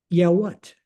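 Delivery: brusque reply. Retold or imitated. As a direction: imitate an unimpressed young airman. Airman's brusque reply at window.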